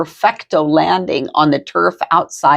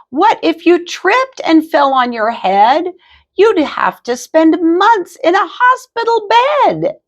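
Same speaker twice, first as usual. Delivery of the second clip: high fretting mother voice rising higher; worried mama; 11 semitones higher than usual. A narrator's reading. Mama scolds Skippy for the wire stunt, high and fretful. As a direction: scold with climbing alarm.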